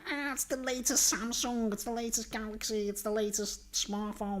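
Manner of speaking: put-on a spoiled child daydreaming about a new phone; excited kid. Toddler imagining the Christmas present, dreamy and breathless. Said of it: "in their heads it's gonna be".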